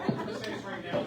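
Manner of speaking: loud Marine voice; loud gung-ho soldier. Real, not acted. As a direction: project it like a drill sergeant. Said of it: "I use my Marine voice".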